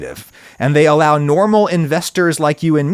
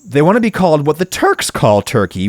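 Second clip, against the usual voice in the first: ordinary delivery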